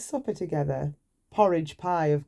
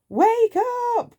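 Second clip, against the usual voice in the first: much higher and louder call; bursting with news; excited cry; 7 dB louder than usual. Acted. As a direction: shout him awake with joy. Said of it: "cried Mister Silly".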